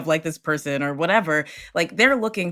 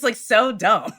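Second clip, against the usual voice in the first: usual speaking voice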